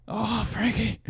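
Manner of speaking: high, dreamy Oh, Frankie; mock swoon. Streamer gushing over his idol, high mock swoon. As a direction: swoon over him like a fangirl.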